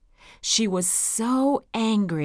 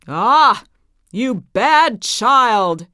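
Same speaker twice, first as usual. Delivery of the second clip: put-on loud, slow, furious old witch; angry witch; 3 semitones higher, 10 dB louder, slower than usual. A narrator's reading. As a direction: bellow it like a wicked witch scolding. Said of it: "cried the witch".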